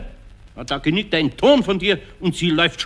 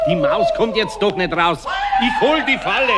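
raised, higher impatient shout; shouting over noise; 5 semitones higher than usual. Acted. Eder cuts Pumuckl short to fetch the trap, shouting. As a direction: shout over the howling to end the talk.

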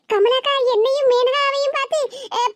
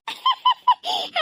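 high teasing burst of laughter; high mocking giggle; 11 semitones higher than usual. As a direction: laugh in her face. Mocking the frightened neighbour.

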